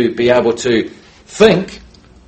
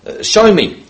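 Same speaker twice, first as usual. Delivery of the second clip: friendly request; 4 semitones higher, 4 dB louder than usual. Retold or imitated. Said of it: "I can say to him".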